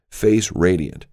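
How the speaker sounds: ordinary delivery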